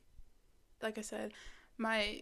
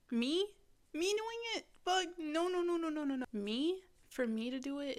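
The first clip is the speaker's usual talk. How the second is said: squeaky, much higher and louder incredulous no; mock disbelief; 7 semitones higher, 3 dB louder than usual. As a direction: scoff at the very idea, voice jumping up. Inner voice mocking her own attempt.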